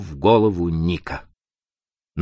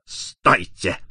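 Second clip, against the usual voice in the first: usual speaking voice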